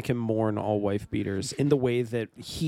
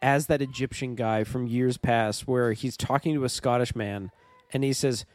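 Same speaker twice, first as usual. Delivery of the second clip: ordinary delivery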